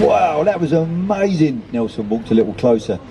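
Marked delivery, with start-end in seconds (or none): 0.0-1.6 slow, high, drawn-out wow of amazement; awed admiration. A narrator's reading. Nelson complimenting the thieving seagull, amazed admiration. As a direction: stretch it out in wonder, pitched up.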